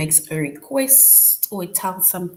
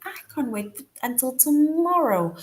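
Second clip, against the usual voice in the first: high, eager "I can't wait"; excited; 7 semitones higher than usual. Shown as a demonstration. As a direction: sound thrilled about tomorrow. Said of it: "because you are excited".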